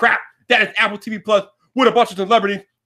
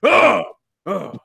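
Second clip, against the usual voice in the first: ordinary delivery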